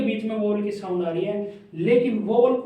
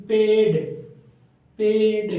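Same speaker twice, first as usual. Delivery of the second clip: पेड said whole and louder after the letters; read aloud clearly; 4 dB louder, much slower than usual. Shown as a demonstration. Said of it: "इस type से हम इसको read करेंगे".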